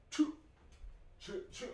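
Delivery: train whistle noise. Pretend.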